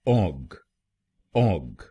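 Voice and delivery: ऑग with an open "aw" and a hard "g", awg; third syllable. Shown as a demonstration. Teacher modeling the third syllable: ऑग with an open aw. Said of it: "ओजी हम बोलेंगे".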